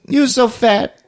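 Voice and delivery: very high mock character voice; high joke voice. Storyteller riffs on Juuso's name, silly high voice. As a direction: keep the silly high-pitched imitation going.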